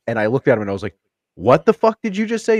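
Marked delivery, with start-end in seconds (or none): 1.3-2.6 high, louder acted demand; retold outraged double-take; incredulous confrontation. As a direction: challenge him in shocked disbelief. Acted confrontation of overheard stranger.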